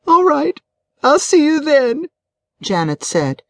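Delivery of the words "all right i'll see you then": high-pitched, needy wife's voice; distressed wife. A narrator's reading. Upset wife asks her friend to meet up, high and distressed. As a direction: speak up high, as if fresh from crying.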